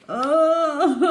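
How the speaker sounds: high, loud wailing sob; crying in pain. A narrator's reading. Crocodile with swollen jaw, sobbing loudly. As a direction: wail like a toothache is killing you. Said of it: "he was crying real tears".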